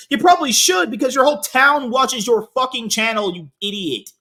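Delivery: loud, high exasperated scolding; angry rebuke. Real, not acted. Angry rebuke of absent streamer.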